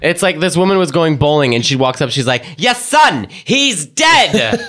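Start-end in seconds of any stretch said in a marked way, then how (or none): 2.6-4.6 high dramatic psychic proclamation; higher hammed-up medium; mock medium. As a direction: declare it like a TV medium. Medium mimicked.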